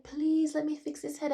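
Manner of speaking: high, loud, tearful begging; retold crying plea. Dreamer begging hospital staff again, high tearful plea. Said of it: "And I'm crying".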